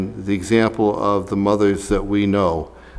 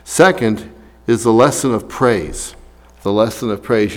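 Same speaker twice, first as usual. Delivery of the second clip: ordinary talk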